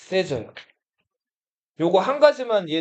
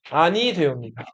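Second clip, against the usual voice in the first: lightly voiced Joseon court official, lower pitch; a hint of old-fashioned courtly remonstrance, pitched down; Joseon-era court speech; 5 semitones lower than usual. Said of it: "조선시대 말투로 어떻게 말해요?".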